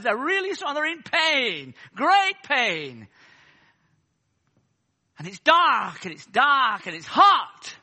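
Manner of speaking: loud revivalist cry, key words stretched; booming imitation of a pulpit orator; imitating American preacher. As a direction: mimic an Afro-American preacher hammering each phrase. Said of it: "an Afro-American preacher".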